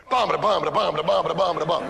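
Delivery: beatbox the cheesy soundtrack; hummed porn music. Retold or imitated.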